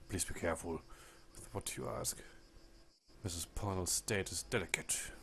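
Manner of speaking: low, hushed, nearly whispered warning in a deep voice; a lightly voiced nun, dropping low and breathy; deep manly voice. Nursing sister warning the doctor, low hushed voice. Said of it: "The sister says in a deep, manly voice".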